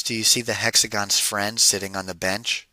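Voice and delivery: plain reading voice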